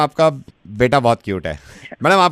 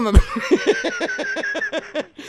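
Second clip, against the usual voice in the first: big laugh. Real, not acted. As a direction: crack up at the reveal. Host laughs after prank reveal. (ज़ोर का ठहाका)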